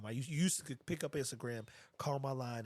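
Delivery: ordinary talk, nothing marked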